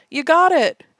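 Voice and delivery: loud, high game show cheer; excited announcement. Retold or imitated. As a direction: bellow it like a game show host. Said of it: "after a pause says".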